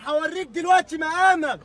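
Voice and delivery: exasperated mom scolding. Real, not acted. Street quarrel, threatening the other man.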